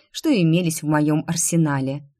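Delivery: ordinary delivery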